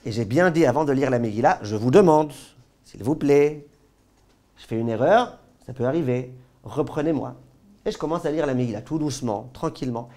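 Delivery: usual speaking voice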